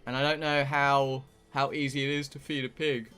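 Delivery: ordinary delivery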